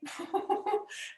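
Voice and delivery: brief high amused laugh; short laugh. Real, not acted. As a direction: laugh it off lightly. Laughs at own bad example.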